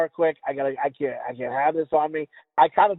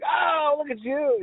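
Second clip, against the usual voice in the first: mock delighted greeting; 10 semitones higher, much slower than usual.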